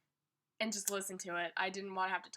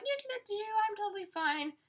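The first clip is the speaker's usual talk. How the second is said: fake fine; 11 semitones higher, slower than usual. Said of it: "going around like".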